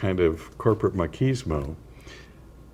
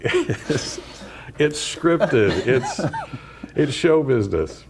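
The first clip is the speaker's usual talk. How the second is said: laughing; 7 semitones higher, much slower than usual. Real, not acted. Laughing at corporate theatre.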